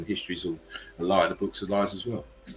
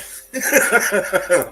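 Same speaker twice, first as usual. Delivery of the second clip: real laugh pitched way up; high laugh; 11 semitones higher, 10 dB louder than usual. Real, not acted. Still laughing after memes.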